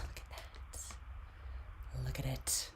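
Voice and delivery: whispered look at it; hushed. Real, not acted. Reader shows off her coffee in a hushed whisper.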